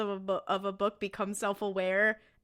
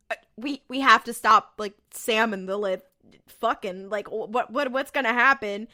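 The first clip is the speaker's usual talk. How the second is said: usual speaking voice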